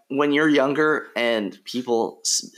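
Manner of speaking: ordinary talk, nothing marked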